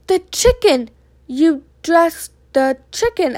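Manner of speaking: loud, high outburst slowing into disbelief; incredulous dismay. A narrator's reading. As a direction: question it in disbelief, drawing the words out. Woman learns chicken was dressed.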